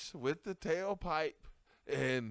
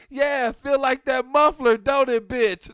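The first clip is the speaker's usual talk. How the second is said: much louder, high gloating taunt; gloating jeer; 9 semitones higher, 16 dB louder than usual. Retold or imitated. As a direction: gloat at the bully's payback. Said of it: "I was like".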